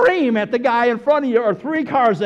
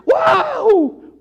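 high, loud shouted whoop; demonstrated cheer; 5 dB louder than usual. Shown as a demonstration. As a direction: holler a big fan's woo. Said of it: "you use those words".

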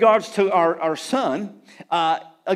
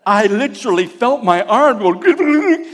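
laughing; 3 semitones higher, 6 dB louder than usual. Retold or imitated. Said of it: "my son said".

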